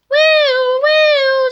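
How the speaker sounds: high, loud siren wail; siren imitation.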